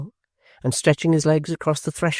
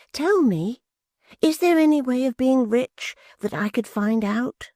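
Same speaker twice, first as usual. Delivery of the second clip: put-on high, pleading simple young man; eager young man voice; 11 semitones higher than usual. Barnaby asks eagerly.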